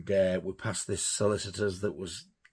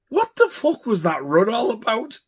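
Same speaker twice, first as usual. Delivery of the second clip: loud, high-pitched disbelief; retold incredulous shout; incredulous demand; 12 semitones higher, 7 dB louder than usual. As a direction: yell it at a mate coming back. Retelling demand to returning friend.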